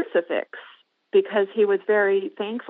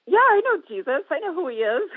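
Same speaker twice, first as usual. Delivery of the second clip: much higher, emphatic retelling of her reply; retold insistent reply; 10 semitones higher than usual. Visitor answers the repeated question about Jesus, retold high-pitched insistence. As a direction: insist in a high voice, half amused.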